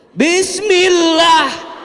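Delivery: very slow, high and loud bismillah; drawn-out loud pious bismillah. Shown as a demonstration. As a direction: stretch it out loud so the caller hears. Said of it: "kencengin suaranya".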